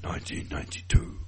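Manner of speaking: dramatic announcement. Acted. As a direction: drag out the big reveal. Dramatic year reveal.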